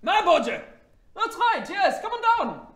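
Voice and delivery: high, loud shouting; excited call upstairs. Acted. Young woman calls father down to his son, high shout. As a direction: holler up to him in a high voice.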